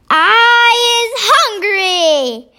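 a big hungry giant calling out; booming giant. A narrator's reading. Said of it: "the giant boomed".

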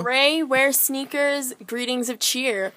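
slow, drawn-out riddle hints; slow musing. Pretend. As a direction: tease the clues out slowly. Slowly rereading riddle clues.

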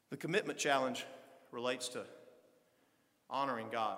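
ordinary delivery

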